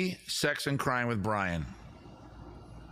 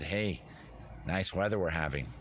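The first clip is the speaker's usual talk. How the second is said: bland normal guy; 7 semitones lower, 4 dB quieter than usual. Retold or imitated. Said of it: "SpongeBob was normal and he just said".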